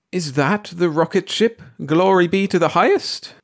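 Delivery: high-pitched, aghast outburst; appalled cry. A narrator's reading. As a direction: cry out in dismay and disbelief. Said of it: "cried Missus Bellows, appalled".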